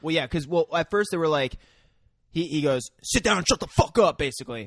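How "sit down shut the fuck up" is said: higher, firm mock command; acted-out stern command; retold sharp order. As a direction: order them to shut up.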